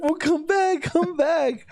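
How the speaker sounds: mock calling out. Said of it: "We're like".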